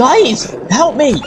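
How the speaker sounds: high frantic cry for help; panicked call. Real, not acted. Being dragged, calling friends for help in panic.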